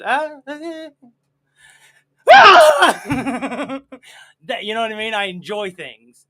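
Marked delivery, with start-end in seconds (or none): 0.0-3.8 high, loud swooning scream; swooning fan scream. Retold or imitated. Host imitates screaming young lady at Elvis film, loud. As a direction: cry out like a fan at a concert. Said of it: "young ladies who, who goes".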